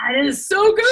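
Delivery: high, loud burst of delight; thrilled, high-pitched outburst; excited cheer. Real, not acted. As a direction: cheer it out, stretching "so". Guest cheering at booking news.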